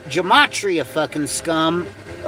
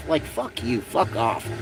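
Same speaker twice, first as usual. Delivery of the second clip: muttered; 9 semitones lower, 9 dB quieter than usual. Real, not acted. Muttered dismissal after rant.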